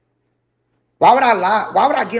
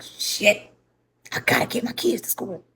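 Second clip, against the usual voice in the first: whispery, voiceless muttered worry; hushed realisation; 3 semitones higher, 7 dB quieter than usual. Retold or imitated. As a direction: softly mutter it to yourself on waking. Retold waking realisation at five.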